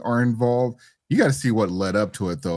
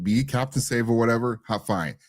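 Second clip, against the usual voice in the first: usual speaking voice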